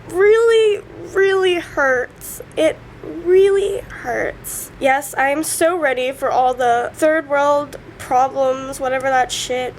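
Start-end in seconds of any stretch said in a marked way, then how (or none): 0.1-4.7 slow, high, drawn-out hurt; tearful. Real, not acted. Confessing to viewers how much it hurts, tearful. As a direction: press out each word through tears. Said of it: "so gross when I cry".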